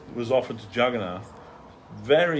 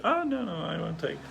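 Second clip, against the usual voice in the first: very high acted "oh no, no"; retold hasty refusal; 7 semitones higher, 5 dB quieter than usual. Devotee refusing the pleading Prabhu, high-pitched refusal. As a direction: wave it off in a lifted voice.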